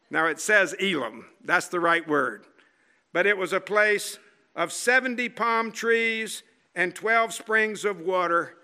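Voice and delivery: ordinary talk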